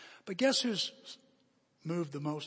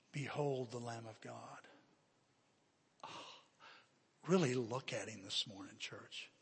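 hushed reverence; 4 semitones lower, 9 dB quieter than usual. Real, not acted. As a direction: let the appeal sink almost to nothing. Solemn appeal to behold the Lamb.

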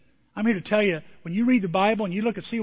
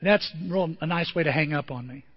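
ordinary delivery

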